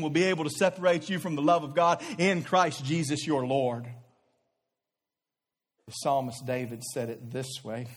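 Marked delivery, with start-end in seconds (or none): none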